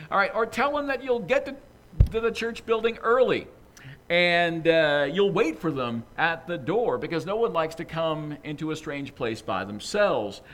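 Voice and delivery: ordinary delivery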